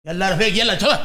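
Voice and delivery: guard shouting. Acted.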